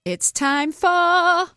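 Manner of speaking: slow, high, drawn-out announcer call; show announcer. Acted. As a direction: announce it like a game show host.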